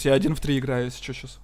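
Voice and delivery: normal talking voice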